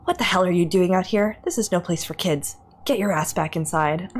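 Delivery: usual speaking voice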